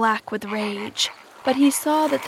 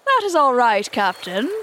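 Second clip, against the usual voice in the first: complacent; 2 semitones higher, 4 dB louder than usual. A narrator's reading. Smee answers his captain complacently. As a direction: reassure the boss complacently. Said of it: "Smee answered complacently".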